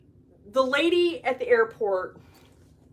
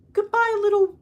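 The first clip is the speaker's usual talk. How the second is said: high, louder sweet farewell; sweet lady; 11 semitones higher, 5 dB louder than usual. Retold or imitated. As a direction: imitate a sweet lady cooing goodbye to a bunny. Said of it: "the sweetest lady".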